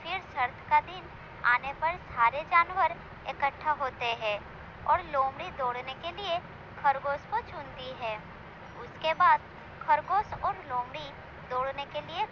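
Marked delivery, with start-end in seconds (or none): none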